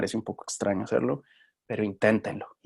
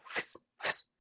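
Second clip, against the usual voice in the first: whispered hissing fis, fis; spray sound; 8 dB quieter than usual. Shown as a demonstration. Teacher showing students how it sounds, hissed spray noise. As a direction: imitate a spray bottle with voiceless hisses. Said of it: "Fíjense el cómo suena".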